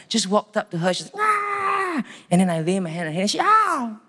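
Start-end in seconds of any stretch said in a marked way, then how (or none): 1.2-2.0 high acted scream; possessed girl shrieking as he walks up; mock shriek. 3.4-4.0 high, loud acted yelp; possessed girl crying out at his hand; mock pained cry. Girl cries out at his touch.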